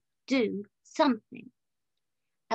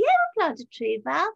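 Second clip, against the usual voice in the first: higher, louder crowd reply; pitched-up, keen shout-back; retold eager agreement; 5 semitones higher, 7 dB louder than usual. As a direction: imitate people eagerly agreeing.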